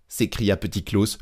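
usual speaking voice

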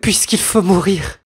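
plead, close to tears; tearful pleading. Acted. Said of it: "les yeux baignés de larmes".

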